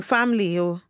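normal talking voice

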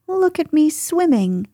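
gleeful, high-pitched boast; bubbling proudly. A narrator's reading. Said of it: "still bubbling proudly".

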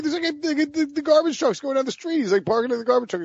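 high, fast, worked-up telling; excited rant. Real, not acted. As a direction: rattle it off all wound up. Animated tale of barking dog.

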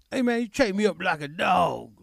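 imitating a rough guy. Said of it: "a guy that acted like Jack Bones".

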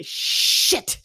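mock dad, a high, loud breathy "Shit!"; dad's way of saying shit. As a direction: burst it out the way her dad did. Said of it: "He had the best way of saying shit".

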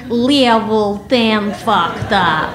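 slow laughing repeat in Russian accent. Shown as a demonstration. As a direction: replay it slowly with the Russian accent. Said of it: "my Russian accent".

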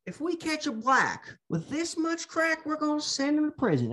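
mock the senator's old accent, speeding up at the end; old Biden accent. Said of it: "what was up with his fucking accent back then".

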